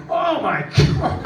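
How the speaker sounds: high, loud, slow stunned cry; be floored as you get passed; laughing high shout of shock; shocked exclamation. Retold or imitated. Guide shocked at being passed.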